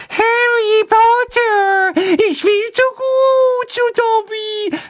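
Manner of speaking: hoch, langsam und gequetscht; quiekende Elfenstimme. A narrator's reading. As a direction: quiek es wie ein überwältigter Hauself. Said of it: "quiekte er". (quiekend, hohe Elfenstimme)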